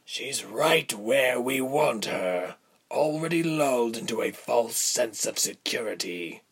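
coarse villain voice, taking his time; unhurried, rough-voiced schemer; slow and coarse. A narrator's reading. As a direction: speak slowly and coarsely as a commander. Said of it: "said slowly and coarsely".

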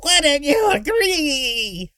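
very high, stretched-out shriek; screeching. A narrator's reading. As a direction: screech the question, dragging the last word. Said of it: "Derek was screeching".

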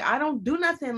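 high retold protest of innocence; exasperated protest.

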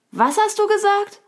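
high, loud character voice; hard-of-hearing raccoon. A narrator's reading. Raccoon who didn't catch the answer asks, high and loud. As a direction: call out the question in a high pitch. Raccoon still did not hear.

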